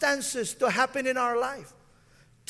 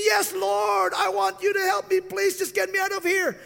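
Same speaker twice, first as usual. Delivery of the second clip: frantic plea; 8 semitones higher than usual. Retold or imitated. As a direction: beg to be pulled out. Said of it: "He said".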